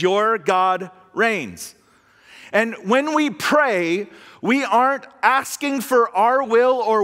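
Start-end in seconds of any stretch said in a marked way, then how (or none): 0.0-1.8 "Your God reigns." said very slowly, word by word; slow emphasis. Shown as a demonstration. Preacher summing gospel in three words, slow. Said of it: "summed up in three words".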